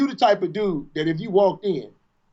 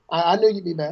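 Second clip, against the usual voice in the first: smug pimp; 5 semitones lower, faster than usual. Retold or imitated. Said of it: "And he come out".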